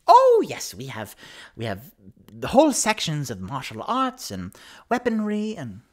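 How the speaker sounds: high loud burst into keen sales chatter; eager shopkeeper. Acted. Bookseller lists sections for the customer, eager high voice. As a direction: explode on the first words, then rattle off eagerly.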